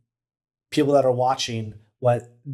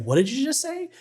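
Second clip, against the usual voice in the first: high, pitched-up parental question; mock parent's scolding; 12 semitones higher than usual. Parent reacting to a kid's bad word, mock scolding. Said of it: "the parent's like".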